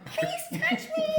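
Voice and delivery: high, slow, laughing please; laughing plea to the spirit. Retold or imitated. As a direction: beg playfully, drawn out.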